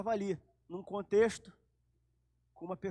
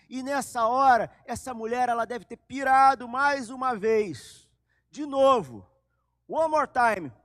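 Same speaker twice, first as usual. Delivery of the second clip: loud, high, rising fervor; excited preaching; 4 semitones higher, 8 dB louder than usual. A narrator's reading. Preacher telling the congregation the woman lost it again, excited and loud. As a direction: drive the story forward with energy.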